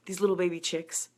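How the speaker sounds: normal talking voice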